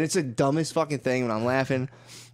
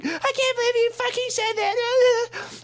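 very high, slow, hammed-up disbelief; mock girlfriend outrage; slower than usual. Boyfriend retelling girlfriend's reaction to candy joke, high mock disbelief. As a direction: squeak it out like she's scandalized. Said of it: "She was just like".

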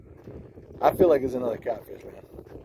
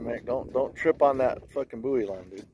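ordinary talk, nothing marked

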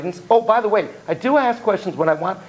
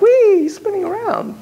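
excited; 3 dB louder than usual. Retold or imitated. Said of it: "You're like".

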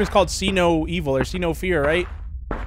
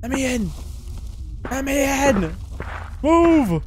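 shouting; 7 semitones higher than usual. Real, not acted. Banging to enter the house.

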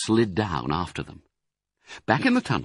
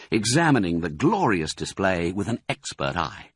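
ordinary delivery

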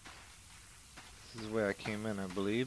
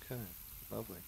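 ordinary talk

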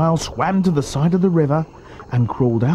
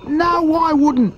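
high, drawn-out little-croc protest; high-pitched, insistent small croc; not-so-big crocodile; 3 dB louder, much slower than usual. A narrator's reading. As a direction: refuse slowly in a high croc voice.

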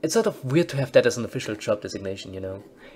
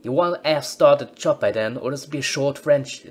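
louder, faltering put-on British accent; 3 semitones higher, 7 dB louder than usual. Officer Chip warning the newcomer in fumbled British accent. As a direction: push the British accent and lose it. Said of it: "I can't do the accent".

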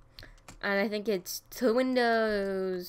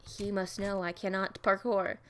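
ordinary talk

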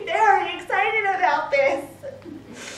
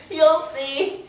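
usual speaking voice

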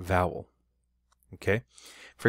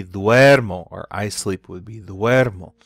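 "duermo" with the first syllable stretched and louder; stressed stem; 9 dB louder than usual. Shown as a demonstration. Teacher showing O change to U E, stress on the "duer" of "duermo". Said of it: "it's not stressed anymore".

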